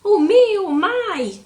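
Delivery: loud, high frightened cry; startled yelp from a scared square; mock fright. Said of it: "was afraid of snakes".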